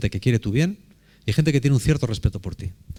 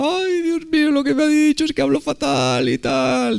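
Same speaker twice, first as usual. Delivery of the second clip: much higher, near-crying voice of an upset foreigner; imitating the distraught girl, high and weepy; tearful German girl; 14 semitones higher than usual. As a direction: wail it high as if close to tears. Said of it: "estaba como llorando".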